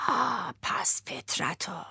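slow hushed whisper; fox whispering. Acted. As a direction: whisper the insult like a sly fox. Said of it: "روباهه گفت".

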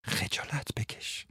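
slow whispered rebuke; soft whisper. Acted. Martha whispering to Frans, slow and soft. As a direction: breathe it out quietly. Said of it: "آهسته فرانس را رها کرد و گفت".

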